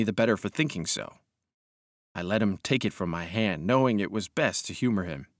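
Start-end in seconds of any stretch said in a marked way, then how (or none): none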